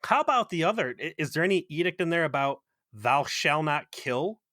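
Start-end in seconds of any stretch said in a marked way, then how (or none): 2.9-4.4 drawn out, mock commandment; slow solemn quote. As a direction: spell out the commandment slowly. Quoting commandment sarcastically.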